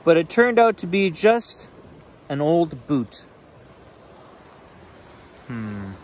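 lively bedtime read-aloud; animated picture book reading; story narration. A narrator's reading.

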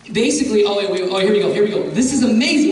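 normal talking voice

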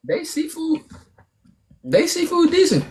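squeaky high voice on the food verdict; high-pitched verdict. Real, not acted. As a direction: pitch it way up, almost falsetto. Grudging praise in high voice.

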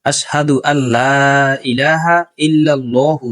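slow, drawn-out Arabic recitation. Shown as a demonstration. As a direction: recite carefully for learners. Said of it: "লাহু না, লহু". (ধীরে শুদ্ধ তিলাওয়াত)

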